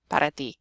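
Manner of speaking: "para ti" run together with tapped R; Spanish para ti. Shown as a demonstration. Teacher confirming the phrase, "para ti" as one Spanish unit. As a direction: say "para ti" smoothly, one light tap. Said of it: "you'll be saying para ti".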